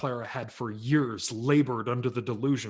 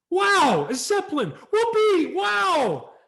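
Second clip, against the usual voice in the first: put-on high, loud whooping boy; ecstatic kid yell; 11 dB louder, slower than usual. Kid brother shouting over his Zeppelin, ecstatic yell. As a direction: shriek with pure delight. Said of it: "with an ear-splitting yell".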